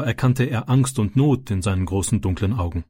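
plain reading voice

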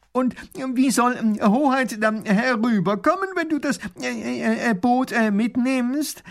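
high, loud, halting question full of ums; a flustered professor searching for words; stammering professor. Acted. Professor stammers a question to the robber. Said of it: "fragt Pfiffikus".

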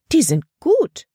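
high, loud burst of approval; delighted exclamation. A narrator's reading. Frau Weaver spots the wildflower drawings, exclaiming. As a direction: exclaim it, pitched right up. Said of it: "rief sie aus".

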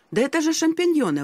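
high, excited exclamation of discovery; exclaiming. A narrator's reading. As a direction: call it out as a happy find. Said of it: "воскликнул я".

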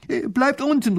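very high, loud call to the guards. Acted. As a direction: shout to keep them back. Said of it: "ruft Professor Pfiffikus den Wachsoldaten zu".

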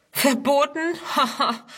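high, scoffing question with a laugh; mocking. A narrator's reading. Youth scoffs at Ernst's warning, mocking. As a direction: repeat it in disbelief, then laugh scornfully. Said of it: "spottet ein anderer".

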